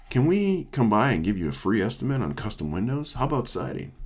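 ordinary delivery

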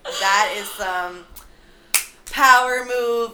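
slow, weighted words with POWER MOVE loud; emphatic awe. Real, not acted. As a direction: land "power move" slowly and loudly. Admiring the heroine's bold move.

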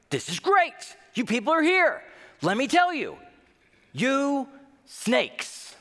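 hammed-up preacher greeting the crowd; fired-up preacher. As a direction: ham up a preacher welcoming the crowd.

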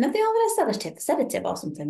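high, fast incredulous question; baffled question. Retold or imitated. Wondering aloud about the sleepy care-home lounge, high and quick. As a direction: squeak it out in disbelief. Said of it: "was like".

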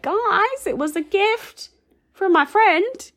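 put-on high, squeaky little-girl voice. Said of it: "in her, like, little squeaky voice".

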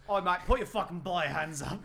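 put-on British-Aussie mate voice; mock British accent, Australian-sounding. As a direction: imitate a British frontman, sliding into Australian. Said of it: "Well, that's Australian".

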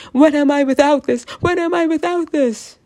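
mock-distressed self-questioning; mock panic. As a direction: play an anxious thought spiralling upward. Acts out panicked thought on pills.

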